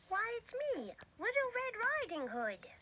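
put-on high, breathy little girl. Girl answering from outside, high and hushed. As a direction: whisper it high like a small girl.

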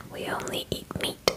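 ordinary talk

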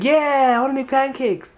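high, eager yes; excited. Shown as a demonstration. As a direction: show excitement for pancakes. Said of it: "Look excited".

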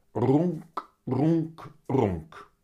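boy pretending to purr. A narrator's reading. Willem is content with his train, pretend purr. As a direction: purr along like a contented child. Boy imitates purring, playing.

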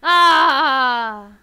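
loud drawn-out "ah"; startled cry. Real, not acted. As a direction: cry out loudly, letting it trail. Startled by the game.

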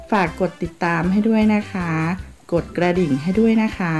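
ordinary delivery

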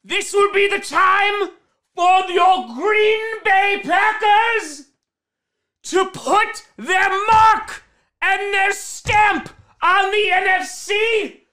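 high-pitched, drawn-out big announcer intro; rousing pregame hype call. Acted. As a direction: build the hype, stretching the key words.

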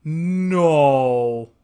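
loud horrified no; shouted no. Real, not acted. Reacting to the reveal, a loud horrified no. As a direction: yell no at the screen.

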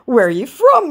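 hammed-up scammer asking; mock scammer voice. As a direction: scammer prying, mocked in a high voice. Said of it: "he asks".

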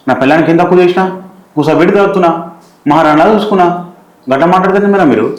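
hurt, indignant pleading; indignant protest. Acted. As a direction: protest your innocence with the pitch pushed up. Husband defends himself to madam.

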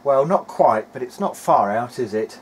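normal talking voice

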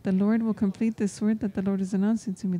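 ordinary talk, nothing marked

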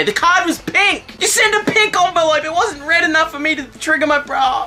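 dismayed outburst. Real, not acted. Realises he opened birthday card.

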